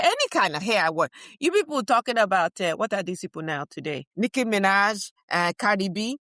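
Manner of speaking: ordinary talk, nothing marked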